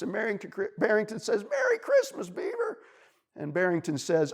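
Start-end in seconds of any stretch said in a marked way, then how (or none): 1.4-2.8 very high, piping voice; friendly bunny. A narrator's reading. Bunny answers beaver at the shore, piping. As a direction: pipe the greeting back.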